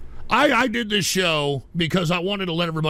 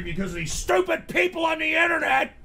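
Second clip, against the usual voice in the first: getting angry; 9 semitones higher than usual. Real, not acted. Streamer explaining himself to Pinochet, getting angry. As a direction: let the irritation break through. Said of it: "I know I'm getting angry".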